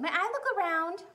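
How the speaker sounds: put-on high little girl voice; eager girl. Lisa asks the manager, high and hopeful. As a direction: ask hopefully.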